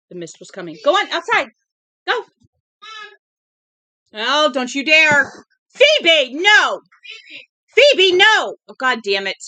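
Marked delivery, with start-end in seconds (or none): 0.8-2.2 loud, much higher command to the dog; real shout to chase the dog out. Real, not acted. Ordering the dog outside. 4.1-9.4 very loud, much higher yell, slowing on the first words; real alarm, stop the dog now. Real, not acted. Dog chases the cats.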